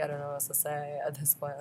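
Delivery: normal talking voice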